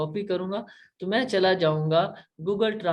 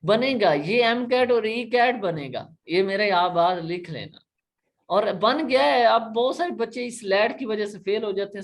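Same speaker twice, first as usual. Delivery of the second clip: higher, louder insistent teacher; raised, forceful voice; emphatic prediction; 6 semitones higher, 4 dB louder than usual. Real, not acted. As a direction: drive the point home with energy. Teacher stressing an exam prediction.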